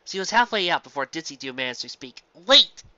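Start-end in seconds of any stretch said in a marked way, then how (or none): 2.3-2.8 loud rising "Wait!"; sudden loud exclaim. A narrator's reading. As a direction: call her back urgently. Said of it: "she exclaimed".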